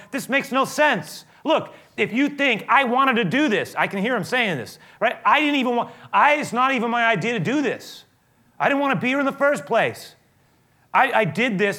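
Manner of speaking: high, loud protest, fed up; exasperated Moses. Retold or imitated. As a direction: throw it back at the accusers in frustration. Moses exasperated answering the crowd.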